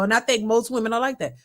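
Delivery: normal talking voice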